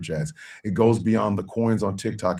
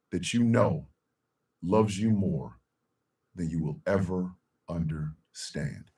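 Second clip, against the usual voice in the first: tender. Real, not acted. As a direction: speak gently to each viewer. Tender sign-off.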